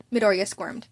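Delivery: ordinary delivery, nothing marked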